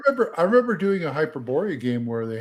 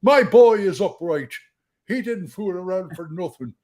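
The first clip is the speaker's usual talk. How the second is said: higher-pitched Irish dwarf retort; 6 semitones higher, 4 dB louder than usual. Acted. Irish dwarf defending his boy to neighbor, indignant Irish accent. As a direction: argue back in a put-on Irish brogue. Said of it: "And the other one was Irish".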